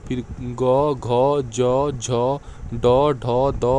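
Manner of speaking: letters recited clearly like a teacher; reciting letters. Shown as a demonstration. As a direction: drill the sounds one by one for students. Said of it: "जैसे".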